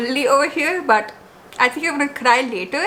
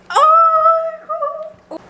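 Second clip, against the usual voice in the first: very high, loud, drawn-out near-tearful cry; slow, high, emotional squeal; overwhelmed squeal; 5 dB louder, much slower than usual. Real, not acted. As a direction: stretch it out, on the verge of tears. Overwhelmed by a handmade gift.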